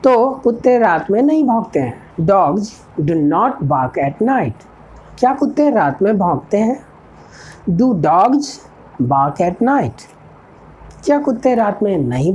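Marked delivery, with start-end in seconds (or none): 2.3-4.7 each word spaced out for learners; a teacher dictating an English model sentence, slow and clear; slow dictation. Shown as a demonstration. 7.7-10.1 each word spaced out for learners; a teacher dictating an English model sentence, slow and clear; slow dictation. Shown as a demonstration. Teacher slowly dictates model question.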